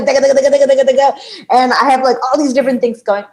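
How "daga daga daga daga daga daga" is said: rapid, higher rattling nonsense syllables; racing-mind chatter. Retold or imitated. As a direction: rattle it off like a busy head. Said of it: "My head is like".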